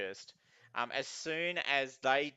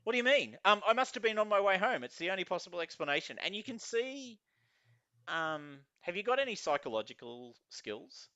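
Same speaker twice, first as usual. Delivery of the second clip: higher-pitched, flustered reasoning; bewildered man; 6 semitones higher than usual. Acted. Confused man explaining himself to platform security, bewildered. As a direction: insist on it in a puzzled, raised voice.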